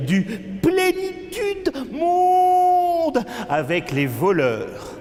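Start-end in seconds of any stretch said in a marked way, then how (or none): 0.1-3.3 high, slow drawn-out cry; theatrical wailing chant, very high; wailed refrain. Acted. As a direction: soar up and hold the last word. Refrain cried out in poem.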